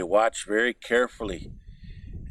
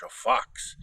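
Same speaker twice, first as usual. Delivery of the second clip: usual speaking voice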